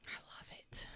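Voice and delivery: hushed, barely voiced whisper; soft whispered delight. Real, not acted. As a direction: whisper it as an approving aside. Whispered aside to the listener.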